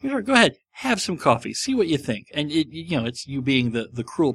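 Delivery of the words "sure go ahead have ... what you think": mock generous parent. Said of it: "you're like".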